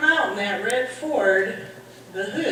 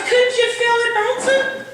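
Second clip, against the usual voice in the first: higher, louder disbelieving question; incredulous yell; 11 semitones higher, 6 dB louder than usual. Retold or imitated. Husband asking his wife in disbelief, loud incredulous shout. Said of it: "And he goes".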